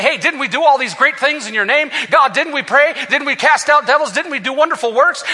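high, loud, mock pleading; pleading crowd. Preacher voicing people pleading before Jesus, mock pleading. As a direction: ham up the people begging to be let in. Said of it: "they're gonna say".

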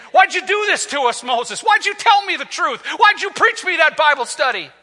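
high, urgent accusing questions; shaken complaint. Acted. Frightened people blame Moses for leading them out, shaken. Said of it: "They're standing there shaking".

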